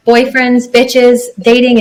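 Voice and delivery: usual speaking voice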